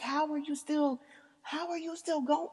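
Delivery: high, soft mock whine; acted-out helpless astonishment; exhausted disbelief. Acts out her exhausted disbelief.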